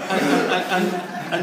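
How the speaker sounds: stammering through real laughter, loud; laughing. Real, not acted. Preacher laughing while telling the congregation his story. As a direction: keep laughing as you try to restart.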